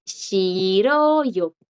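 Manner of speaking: shiroyo stretched out syllable by syllable; drawn-out shi-ro-yo, rising on ro and falling away; silent J. Shown as a demonstration. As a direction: model shiroyo slowly, no h before the vowel. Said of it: "la J no".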